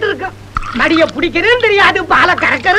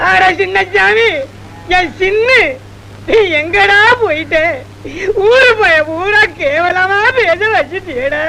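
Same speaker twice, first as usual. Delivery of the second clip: slow, drawn-out grieving call; father calling out for his lost son, slow and grieving; slow lament; slower than usual. Acted. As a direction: mourn him, stretching every word.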